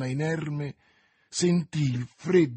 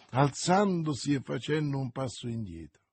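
usual speaking voice

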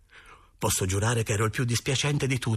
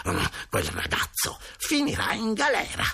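irritated grumble rising to a high pitch; grumble, then pitch it up in vexation; peeved grumbling; 5 semitones higher than usual. A narrator's reading. Signorina Stulli cleaning her stained blouse, grumbling crossly. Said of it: "borbottando tutta stizzita".

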